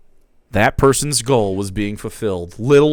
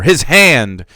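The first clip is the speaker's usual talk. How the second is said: louder dramatic "His hand!"; a louder shocked cry; horrified exclamation; 3 semitones higher, 9 dB louder than usual. A narrator's reading. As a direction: cry out the horror. Horror narration of Shad's hand.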